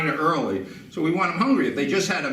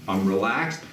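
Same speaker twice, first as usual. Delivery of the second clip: ordinary delivery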